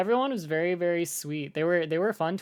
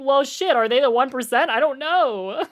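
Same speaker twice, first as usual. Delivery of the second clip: mock panic; 8 semitones higher, 7 dB louder than usual. Imagined panicked inner thought.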